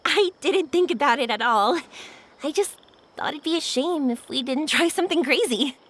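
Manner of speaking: normal talking voice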